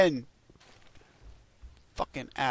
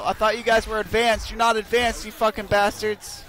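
usual speaking voice

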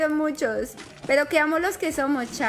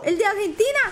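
put-on excited Mexican accent; 10 semitones higher than usual. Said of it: "¿Dónde aprendiste ese acento?". (grito emocionado con acento mexicano)

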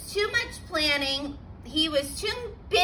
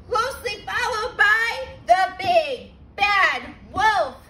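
ordinary delivery, nothing marked

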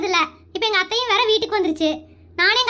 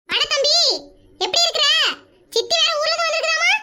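a higher, teasing elder sister; lightly voiced sister, needling her brother; older sister voice; 5 semitones higher than usual. Wife's actor voices the older sister.

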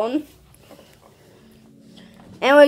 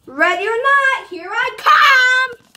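spooky seeker call; 8 semitones higher, 4 dB louder, slower than usual. Pretend. Seeker calling out in game.

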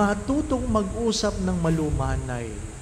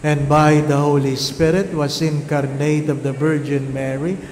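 loud, steady recitation of the Creed; congregational creed recitation; 7 dB louder than usual. Acted. Leads the Creed. (malakas na sabayang pagbigkas ng Kredo)

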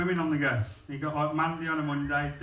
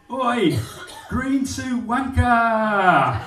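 loud, slow, higher jeering yell; factory heckle; 7 semitones higher, 7 dB louder, much slower than usual. Retold or imitated. Factory worker heckling a passer-by, loud slow jeering yell. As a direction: bellow the insult across the shop floor. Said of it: "I get a different heckle".

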